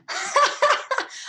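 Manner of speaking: high burst of laughter; surprised amusement laugh. Real, not acted. Coach admits it was not planned, high amused laugh. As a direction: laugh off the unplanned interruption.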